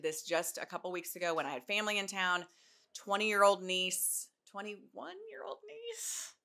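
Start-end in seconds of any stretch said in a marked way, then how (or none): 4.5-6.3 much higher, slow querying rise; self-correcting question. Real, not acted. During the sponsor read, correcting her niece's age, questioning rise. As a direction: check yourself mid-sentence. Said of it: "She's twenty".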